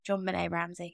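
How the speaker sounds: ordinary talk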